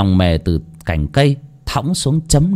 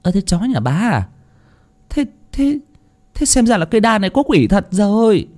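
high, far-off, stammering voice; bystander's voice; 11 semitones higher than usual. A narrator's reading. Bystander asking Mrs Xuân about the dog, high stammering voice. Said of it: "hỏi lại".

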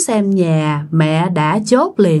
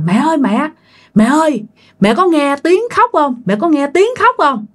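scared calling; 6 semitones higher than usual. Retold or imitated. Scared child wakes mother.

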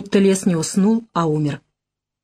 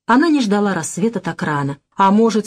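ordinary delivery, nothing marked

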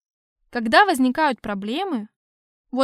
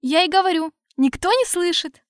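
exasperated; 6 semitones higher, 4 dB louder than usual. Acted. Exasperated retort.

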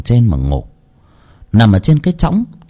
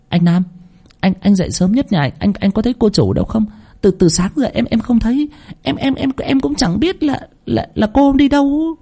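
put-on much higher, stammering frightened servant girl; breathless stammering maid; 12 semitones higher than usual. Maid panicking to her master about the missing girl, stammering high. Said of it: "con hầu liền chạy đến".

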